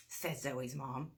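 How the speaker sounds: plain reading voice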